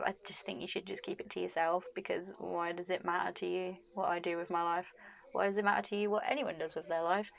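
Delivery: ordinary talk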